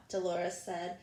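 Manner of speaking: ordinary delivery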